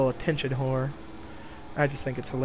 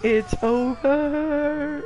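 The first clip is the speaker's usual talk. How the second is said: drawn-out "it's over"; 12 semitones higher, much slower than usual. Real, not acted. After chaos in game.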